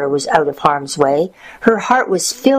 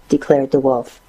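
usual speaking voice

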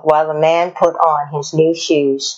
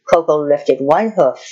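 ordinary delivery

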